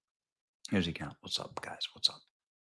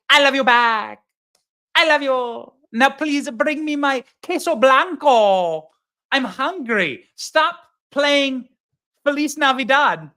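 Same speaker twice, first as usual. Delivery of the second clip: lightly voiced Kamala mockery, slow in places; 6 semitones higher, slower than usual. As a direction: parody her pandering to a restaurant crowd. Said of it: "accent switch Kamala".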